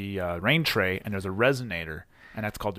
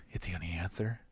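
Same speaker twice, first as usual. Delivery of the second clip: soft unvoiced whisper; 6 semitones lower, 14 dB quieter than usual. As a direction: breathe it out in a whisper. Whispered joke line.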